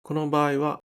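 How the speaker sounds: usual speaking voice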